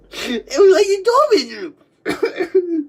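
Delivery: slow, laugh-crying words; words breaking through tears of laughter. Real, not acted.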